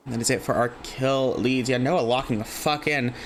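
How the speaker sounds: ordinary talk, nothing marked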